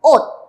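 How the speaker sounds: "ot." ending in an unreleased t, not s; "ot." con t final sin soltar; Korean final t. Shown as a demonstration. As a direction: clip "ot." off with a held t. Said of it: "esta sílaba vamos a leer".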